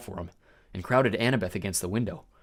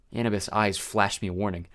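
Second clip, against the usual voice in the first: usual speaking voice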